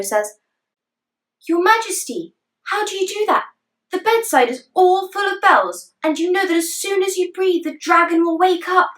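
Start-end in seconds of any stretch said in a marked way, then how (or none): 1.5-8.9 put-on worried boy. Tradishino protesting to king.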